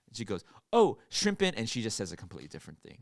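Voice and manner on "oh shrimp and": high, loud imitation of a waitress; correcting waitress. Waitress corrects a customer's order, loud imitated correction. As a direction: correct the customer with a pointed, raised voice. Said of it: "like, correcting me".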